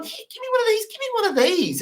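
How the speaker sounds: very high squeaky begging; wheedling plea. Retold or imitated. Egyptian guy begging for a cigarette, very high squeaky. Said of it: "the guy's going".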